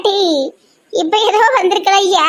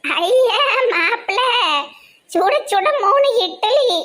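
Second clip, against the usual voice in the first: fake doting mother-in-law; 6 semitones higher, 3 dB quieter than usual. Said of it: "நீங்க ரொம்ப நடிக்காதீங்க".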